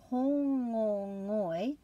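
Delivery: hōngongoi said very slowly, a break after each vowel; broken into vowels. Shown as a demonstration. Teacher to class, modelling the word slowly. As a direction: spell out hōngongoi syllable by syllable. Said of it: "make a break after each of those vowels".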